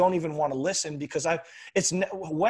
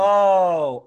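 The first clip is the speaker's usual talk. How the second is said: long, louder 'oh', pitched up; mock moment of understanding; acted realization; 4 semitones higher, 7 dB louder than usual. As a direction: let it dawn on you in one drawn-out sound. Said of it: "rather than being like".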